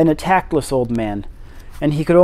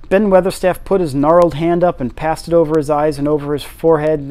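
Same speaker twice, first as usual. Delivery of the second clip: ordinary delivery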